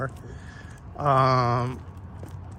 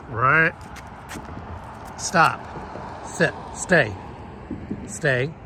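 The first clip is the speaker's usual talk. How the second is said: firm dog commands; 2 semitones higher, much slower than usual. Real, not acted. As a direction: call the dog to heel, sharp and firm.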